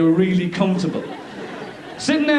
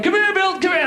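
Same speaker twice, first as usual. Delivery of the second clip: much higher, coaxing a dog; high calling voice; 11 semitones higher than usual. Retold or imitated. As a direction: call the dog sweetly, high.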